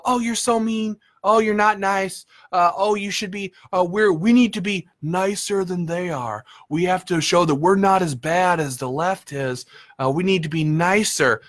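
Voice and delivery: mocking the nice ones. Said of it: "they go".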